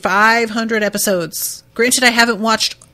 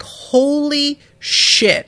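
higher, slow, stunned gasp of awe; 6 semitones higher, much slower than usual. Retold or imitated. Crowd on shore watching Luffy's punch, slow and awed. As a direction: let it hang in disbelief.

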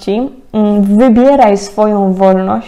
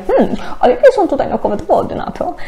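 higher-pitched mock doubting voice; inner skeptic; 7 semitones higher than usual. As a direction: play the doubter questioning it with a hum. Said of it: "taką część też niedowiarka".

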